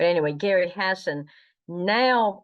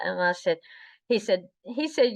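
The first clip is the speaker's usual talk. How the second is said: usual speaking voice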